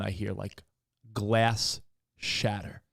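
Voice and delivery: normal talking voice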